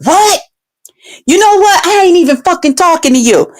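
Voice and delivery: high-pitched mock flip-out; retold angry outburst. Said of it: "He flipped the fuck out".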